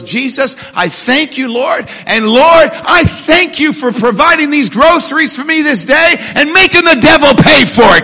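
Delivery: high, loud thanksgiving building to a shout; acted jubilant prayer. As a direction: play the woman praising triumphantly. Old woman's triumphant prayer, acted.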